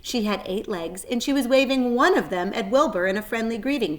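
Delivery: usual speaking voice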